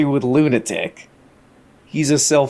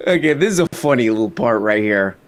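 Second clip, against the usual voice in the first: normal talking voice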